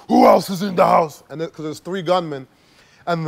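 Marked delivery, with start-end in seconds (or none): 0.1-1.2 shouted louder the second time, demanding; robber's shout. Retold or imitated. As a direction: bark the question at the room. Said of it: "Well, it's like".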